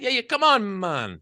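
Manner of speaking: briefly put-on Jamaican lilt; mock Jamaican accent. Teasing about whether it's allowed, mock Jamaican accent. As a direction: slip into a teasing fake Jamaican. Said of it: "To do a Jamaican accent?".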